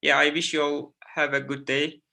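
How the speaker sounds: ordinary talk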